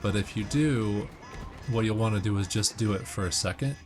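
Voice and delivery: ordinary talk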